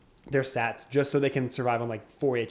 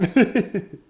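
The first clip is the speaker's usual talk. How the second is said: laugh; 8 semitones higher, 11 dB louder than usual. Real, not acted. Laughing after losing to opponent.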